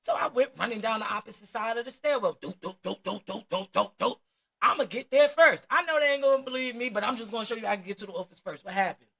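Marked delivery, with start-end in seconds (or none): 2.4-4.2 quick doop doop footstep noises; running footsteps sound. Retold or imitated. Racing down the stairwell, quick doop footstep noises. 4.6-6.9 loud, determined rushing voice. Retold or imitated. Racing the teacher to the office, loud determined rush.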